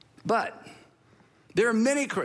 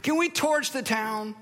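higher, drawn-out question in mild disbelief; incredulous repeat; 5 semitones higher, slower than usual. Retold or imitated. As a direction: repeat it as if it's outrageous. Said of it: "They ask him that".

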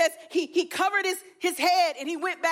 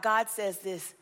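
ordinary talk

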